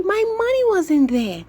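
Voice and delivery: high, loud and alarmed; upset letter P. Acted. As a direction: wail about the lost money.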